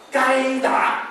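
"该打" said high and punched; firm verdict. Shown as a demonstration. Grandma told to scold the child, firm "该打". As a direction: snap "该打" out as a ruling. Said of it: "你只可以讲一句话".

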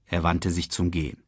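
usual speaking voice

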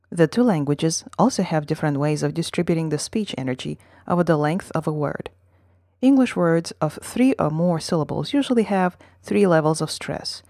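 usual speaking voice

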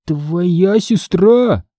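very slow, incredulous question; astonished. A narrator's reading. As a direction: stretch each word in amazement. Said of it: "поразился я".

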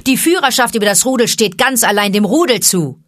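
heated public challenge, high and loud; calling out. A narrator's reading. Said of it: "rief Mowgli". (laut und aufgebracht in die Runde gerufen)

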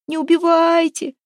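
high, loud wail for mercy; shrieking plea. A narrator's reading. Captive begs the men not to kill, shrieking plea. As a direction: squeal it out, begging. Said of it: "верещала пленница".

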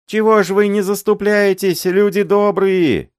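high, loud desperate pleading; old man wailing. A narrator's reading. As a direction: beg and wail, voice up high. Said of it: "этот воющий голос".

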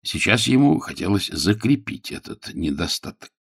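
ordinary delivery

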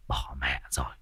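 breathless whisper of dismay; whispered curse. Acted. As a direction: hiss it under your breath. (thì thầm hốt hoảng)

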